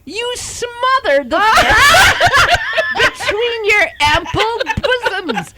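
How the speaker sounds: high, drawn-out words through laughter; laughing outcry. Real, not acted. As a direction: cry it out slowly while cracking up. Teasing friend, cracking up.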